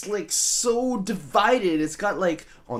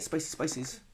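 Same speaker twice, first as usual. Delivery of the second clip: ordinary delivery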